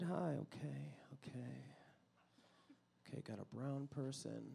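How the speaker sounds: unvoiced hushed okay; whisper. Real, not acted. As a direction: whisper it nervously. Whispering to audience side.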